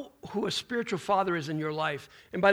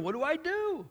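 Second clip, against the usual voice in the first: high, worried what-do-I-do; anxious retold question; 9 semitones higher, 3 dB quieter than usual. Friend asking pastor what to do, high and worried.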